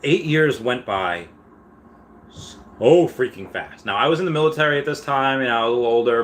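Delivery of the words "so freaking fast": slow emphasis. Real, not acted. Marvelling at passing years.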